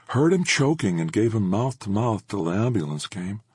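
a hint of an eager neighbor; middle-aged woman voice. A narrator's reading.

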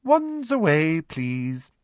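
crisp, self-satisfied classroom order; prim teacher. A narrator's reading. Umbridge instructing her class, smug.

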